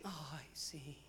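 whispered, swooning sigh of a yes; tender whisper. Acted. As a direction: breathe it out like someone in love. Acts out tender lover's answer. (susurro tierno y enamorado)

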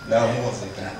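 ordinary delivery, nothing marked

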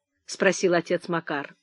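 ordinary delivery, nothing marked

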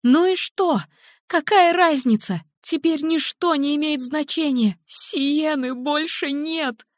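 high, tearful mother, slowing at the end; sobbing. A narrator's reading. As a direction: let the last words break into a sob. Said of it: "всхлипнула она".

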